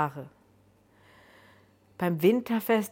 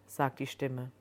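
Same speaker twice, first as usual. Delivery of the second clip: ordinary delivery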